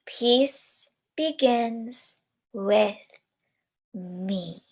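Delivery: slow, calm chant; slow final affirmation. Shown as a demonstration. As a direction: say it slowly together. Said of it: "say it all together one more time".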